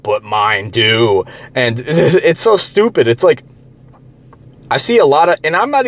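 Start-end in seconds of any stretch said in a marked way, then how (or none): 0.0-1.2 low, drawn-out parody of opponents; slow, lowered mimicry; mocking quote. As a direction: drag the words out to mock a smug arguer. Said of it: "they like to say".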